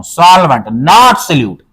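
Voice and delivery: very slow, each word weighed out; emphatic contrast. Shown as a demonstration. Teacher stressing to students, slowly spelled out. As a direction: make sure they hear solvent not solute. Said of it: "मैं क्या कह रहा हूं".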